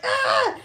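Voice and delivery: high, loud shriek of fright; acted scream. Retelling seeing the wolf spider, high loud scream. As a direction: shriek as if a spider is coming at you.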